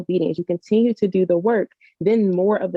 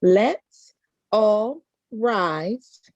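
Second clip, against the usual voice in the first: slow drawn call; 3 semitones higher, much slower than usual. Acted. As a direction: stretch every word slowly, all drawn out. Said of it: "Come on".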